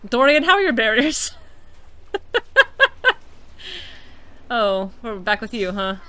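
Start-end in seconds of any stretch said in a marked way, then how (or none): 1.4-4.4 airy giggle high up; high breathy laugh. Real, not acted. Streamer giggling at her own joke, high airy laugh.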